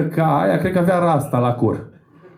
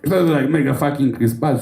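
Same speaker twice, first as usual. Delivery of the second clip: ordinary talk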